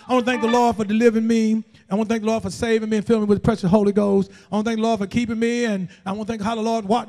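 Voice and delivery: rote church testimony. Retold or imitated. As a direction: race through a canned thanks, imitated.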